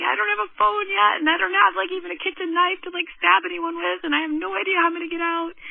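high-pitched retold panic; freaking out. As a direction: race through worried thoughts in a high voice. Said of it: "I'm freaking out".

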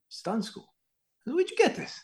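very high, softened incredulous question; retold astonishment. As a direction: act out a baffled teacher, pitch leaping up. Teacher retells his surprise at printout.

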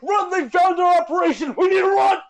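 shrill frantic yell; high panicked shout. Pretend. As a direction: scream the warning in panic. Dramatic escape skit.

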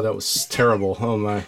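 ordinary talk

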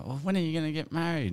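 a hint of a nosy old grandma; nagging grandma. Grandma voiced at a wedding.

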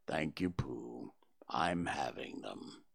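low, slow, whispered gloom; low gloomy near-whisper. A narrator's reading. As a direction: breathe it out like nothing matters. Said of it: "said Eeyore gloomily".

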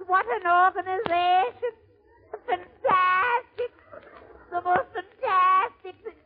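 very high, awestruck Irish voice; Irish immigrant's brogue. Acted. Irish newcomer amazed by the streetcar conductor, high Irish accent. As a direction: marvel in a high Irish singsong, drawing out the last word. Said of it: "this is an Irish story".

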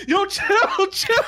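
high, laughing plea to calm down; panicked mom. Retold or imitated. Mom begging the angry dad in the car, high panicked plea. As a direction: squeal chill through a laugh.

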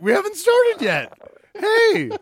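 high yelled protest; mock shout. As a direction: squeal it out in alarm. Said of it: "It was like".

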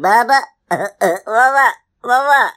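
high, loud little cub calling for its mother; pleading baby bear. Acted. Baby bear calls up to its mother, pleading. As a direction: beg like a small child wanting attention. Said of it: "obviously there's a pleading voice".

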